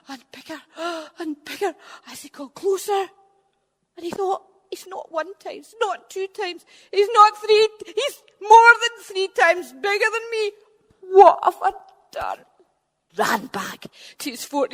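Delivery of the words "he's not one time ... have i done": high panicky giant, rising to a cry; panicking. Acted. Finn seeing the huge giant approach, panicking. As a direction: panic higher and higher.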